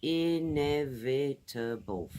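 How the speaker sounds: inevitable said slowly, all five syllables clear; slow syllable model. Shown as a demonstration. Teacher correcting herself to students, slow five-syllable inevitable. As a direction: spell out inevitable in five beats. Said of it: "Five syllables".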